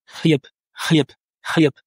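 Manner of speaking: khliep with a spat, hissing kh like an angry cat; khliep, the kh hissed like a cat spitting. Shown as a demonstration. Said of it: "il s'agit d'imiter, euh, le chat quand il est énervé et quand il crache".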